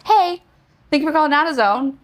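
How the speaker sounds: high, chirpy store-clerk greeting; imitating a phone clerk. AutoZone clerk picking up her call, high and chirpy. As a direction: answer the phone like a bubbly clerk. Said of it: "he goes".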